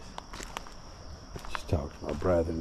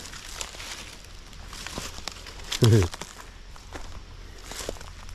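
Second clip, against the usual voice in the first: breathy giggle; 2 semitones higher, 3 dB quieter than usual. Real, not acted. Giggling on a riverbank.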